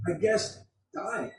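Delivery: soft, hesitant acted answer; pondering, hesitant. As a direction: offer it quietly after long thought. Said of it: "the young man ponders long this time".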